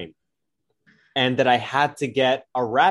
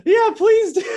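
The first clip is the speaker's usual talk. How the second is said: much higher, louder, squeaky laughing cry; voice gone high; 7 dB louder than usual. Real, not acted. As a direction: voice cracking up high through laughter. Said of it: "my voice is high".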